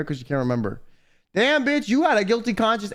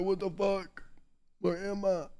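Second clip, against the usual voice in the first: very soft, just-woken murmur; retold dazed, quiet waking voice; groggy waking confusion; 11 dB quieter than usual. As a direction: murmur it as if barely awake. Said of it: "I woke up and I was like".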